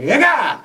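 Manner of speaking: short, high-pitched nonsense cry; triumphant mock yell. Acting out coming up with lyrics, triumphant yelp. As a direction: burst out with a high mock shout.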